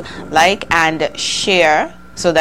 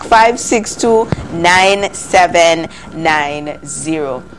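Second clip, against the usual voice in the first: phone number read out slowly, digit by digit; slow right down so callers can write it; slow, spelled-out number; much slower than usual. Shown as a demonstration. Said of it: "Neighbor, call back".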